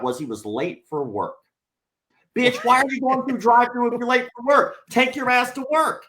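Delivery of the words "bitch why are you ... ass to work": high, loud incredulous outburst; exasperated rant. Real, not acted. Venting at the rude drive-thru customer, loud high exasperated shout. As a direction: blast the customer who says he's late.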